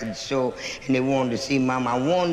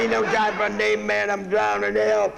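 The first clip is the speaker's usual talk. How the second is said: higher, mock urgent plea; hammed-up panic from the water; drowning man; 6 semitones higher than usual. Drowning man begging the stranger for help, mock urgent.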